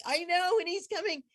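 high, eager reply; can barely contain herself; excited. Retold or imitated. Answering the man on the beach, high and excited. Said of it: "I'm so excited".

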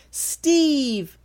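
very high, drawn-out squeal of a name; happy yell. A narrator's reading. Tulsi yelling to her old friend, high happy yell. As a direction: call out joyfully without real volume. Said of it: "Tulsi yelled".

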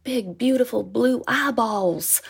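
put-on doting Southern accent over a baby. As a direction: savor each word in a gentle Southern drawl. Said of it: "his accent was very endearing".

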